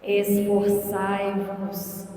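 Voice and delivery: drawn-out, deep "Esforçai-vos"; very slow solemn. Shown as a demonstration. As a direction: stretch every syllable of "Esforçai-vos" solemnly. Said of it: "Repete comigo".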